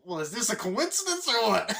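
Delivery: high, loud, chuckling John Candy; John Candy impression. Jolly salesman greets seatmate, high loud chuckling voice. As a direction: ham up the jolly salesman spotting his seatmate. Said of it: "John Candy there going".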